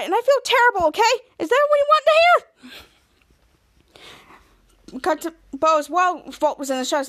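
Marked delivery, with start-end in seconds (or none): 0.0-5.0 high, loud defensive rant; hammed-up guilty outburst; exasperated outburst. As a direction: snap back and own it. Captain Man confesses under pressure.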